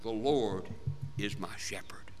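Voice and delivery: soft, breathy hush on the verse; whispered verse. Shown as a demonstration. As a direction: draw them in with a whisper. Hushed repeat of the verse.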